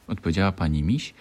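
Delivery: usual speaking voice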